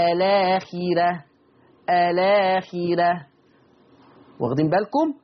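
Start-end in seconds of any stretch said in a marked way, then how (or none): 0.1-3.3 آلاخرة recited slowly with a thin, light ra; ترقيق الراء: آلاخرة ببطء; Warsh thinned ra. Shown as a demonstration. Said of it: "فعشان كده ورش قال إيه؟".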